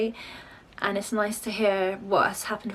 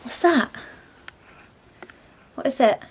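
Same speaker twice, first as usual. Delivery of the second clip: higher, louder coaxing voice; 5 semitones higher, 9 dB louder, slower than usual. Real, not acted. Mum coaxing her toddler to speak, child-directed voice.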